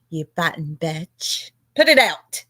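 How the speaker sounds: usual speaking voice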